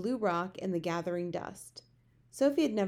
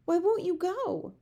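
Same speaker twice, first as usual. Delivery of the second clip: high, slow pleading cry; drawn-out exasperated question, pitched up; 11 semitones higher, 5 dB louder than usual. A narrator's reading. Sophie begs the giant to go, crying out. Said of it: "Sophie cried".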